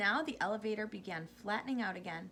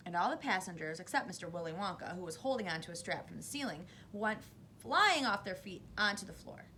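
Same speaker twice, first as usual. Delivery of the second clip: ordinary delivery, nothing marked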